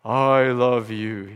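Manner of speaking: slow, drawn-out mock voice from the sky; mock heavenly voice. Preacher mocking God shouting from the sky, slow mock heavenly voice. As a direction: lightly ham up a booming voice from above. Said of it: "shouting from the sky with a heavenly voice".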